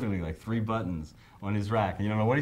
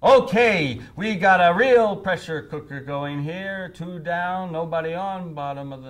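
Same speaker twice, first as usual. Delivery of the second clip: higher, louder and slow, a sportscaster playing it tense; Phil Rizzuto as Al Pacino; 8 semitones higher, 7 dB louder, slower than usual. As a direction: draw out the play-by-play like a gangster-movie announcer. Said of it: "It was Phil Rizzuto as Al Pacino".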